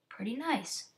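ordinary delivery

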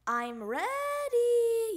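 high, loud, stretched-out shout; little frog hollering back; excited yell. A narrator's reading. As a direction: yell it back slowly and proudly. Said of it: "yelled Froggy".

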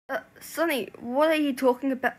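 taken aback and raising the voice; alarmed disbelief. Acted. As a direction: question your friend in loud confusion. Friend alarmed by the confession.

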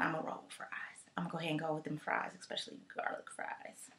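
whispered secret pick, quiet and quick. Real, not acted. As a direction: whisper it like a guilty confession.

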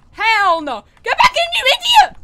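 high, loud, fast panicked shout; real panicked scolding yell; frantic yelling. Real, not acted. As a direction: scream at the character to get back. Panic at game monster.

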